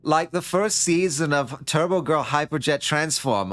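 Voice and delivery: drawn-out Tommy Wiseau delivery; Tommy Wiseau accent. Acted. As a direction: Nomi gushing about the show in a slow Tommy Wiseau accent. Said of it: "doing the accent".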